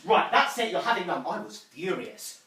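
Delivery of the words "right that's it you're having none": louder, higher telling-off; fed-up mum. Acted. Mum refusing her son blackjacks, snapping crossly. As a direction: lay down the law as a parent. Mum loses patience with child.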